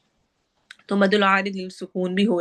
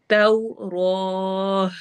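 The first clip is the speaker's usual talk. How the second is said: slow, drawn-out tajweed chant; Quran recitation; much slower than usual. Shown as a demonstration. Teacher recites verse for students, slow tajweed chant. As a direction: recite it slowly, stretching each sound. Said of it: "आखिर में हा की आवाज़ होगी".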